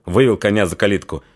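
usual speaking voice

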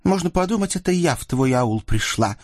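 put-on high-pitched, irritated girl's comeback; testy teenage girl, bickering out of habit; snappish retort. A narrator's reading. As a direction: throw the blame straight back. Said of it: "привычно огрызнулась Сотникова дочь".

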